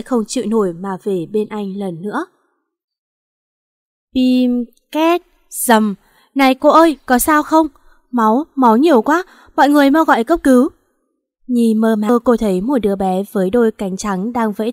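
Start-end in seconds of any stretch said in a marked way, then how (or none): 4.1-5.9 mimicked horn, screech and crash; crash sound effect. Tai nạn xe trên đường. 6.3-10.7 panicked call, louder and higher, rushing at the end; alarmed bystander shouting. Acted. Người đi đường thấy tai nạn.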